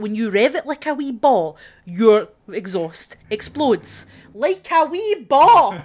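slow, loud and exaggerated; loud mock threat. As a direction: drag it out like a playful threat. Threat at the driver.

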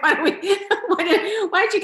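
high, breaking into laughter mid-quote; can't finish the line for giggling; laughing through the quote. Retold or imitated. Laughing while quoting the master.

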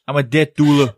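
hammed-up New Yorker; New York accent. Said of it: "dealer with a New York accent".

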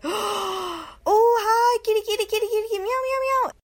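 very high, loud sing-song call to a cat. Retold or imitated. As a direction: coo at a street cat in a squeaky high voice. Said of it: "I'm like".

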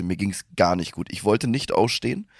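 usual speaking voice

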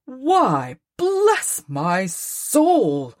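surprised cry, slowed on bless my soul; a delighted, startled outcry at the door; Fred calling out in happy surprise; cried out. A narrator's reading. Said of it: "cried Fred".

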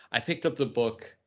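ordinary delivery

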